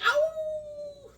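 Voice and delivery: very high hammed-up wolf howl; werewolf howl. Vlogger imitates werewolf from comic, high mock howl. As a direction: throw your head back and howl. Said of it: "He's like".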